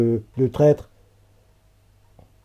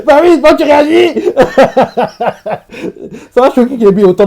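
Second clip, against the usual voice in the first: laughing; 12 semitones higher, 9 dB louder than usual. Real, not acted. Laughing while reacting to episode.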